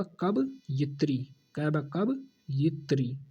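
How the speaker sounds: कवयित्री drawn out slowly; slow model word. Shown as a demonstration. Teacher slowly correcting the student's spelling, very slow. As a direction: dictate कवयित्री syllable by syllable. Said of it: "कवियित्री क्या होगा".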